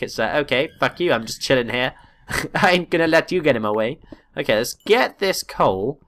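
normal talking voice

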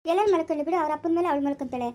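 ordinary talk

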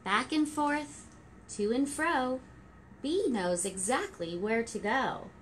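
gentle rhyming storybook read; reading to children. Acted. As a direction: read it like story time for toddlers. Reading rhyming picture book.

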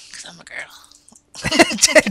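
very slow teasing whisper. Acted. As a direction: breathe it out slowly as a joke. Joking answer, whispered slowly.

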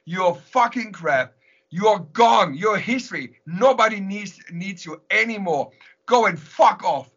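reliving an angry outburst from the top of the wall; enraged yelling. Retold or imitated. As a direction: hurl the curses up at the border police in a rage. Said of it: "I was enraged".

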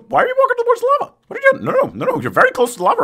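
very high, fast, alarmed pleading; high-pitched panic at a reckless pet; panicked squeal. Real, not acted. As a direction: shriek at the dog to stop. Dog walking toward lava.

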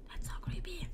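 hushed, voiceless whisper. Real, not acted. Player creeped out by a dark game room, whispering. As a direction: breathe it so nothing hears you.

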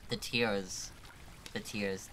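ordinary delivery

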